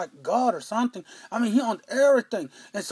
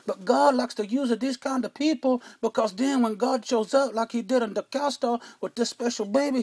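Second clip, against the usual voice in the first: normal talking voice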